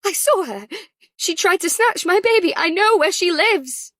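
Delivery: high, loud panicked insistence; shrill panic. Acted. Ellie insisting to the nurse and ward, shrill with panic. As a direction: voice cracking up with panic. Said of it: "Panic made Ellie's voice shrill".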